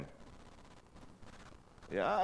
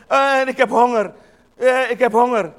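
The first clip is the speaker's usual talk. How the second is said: whining hunger; 7 semitones higher, 10 dB louder than usual. Retold or imitated. People whining for food.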